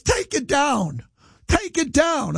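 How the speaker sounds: high, whiny-pitched mock demand; mocking complainer. Host mocking the lady who wants his tweet down, high mimicry. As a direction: mimic an outraged caller in a high voice.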